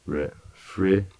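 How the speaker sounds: normal talking voice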